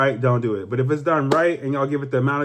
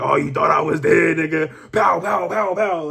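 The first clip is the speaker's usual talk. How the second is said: acted gangster shout with gunshot noises; hammed-up action-movie revenge line; vengeful gangster; 4 semitones higher than usual. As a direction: play the comeback gangster, higher on each pow. Acts out gangster's vengeful return.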